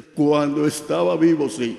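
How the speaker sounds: voz de ultratumba, fuerte; voz de muerto. Acted. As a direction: habla como un fantasma que asusta. El muerto responde. (muerto con voz fuerte y extraña)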